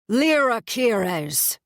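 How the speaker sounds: slow, slurred, loud high call; drunken bellow. A narrator's reading. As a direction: bellow her name, dragged out. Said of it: "he bellows".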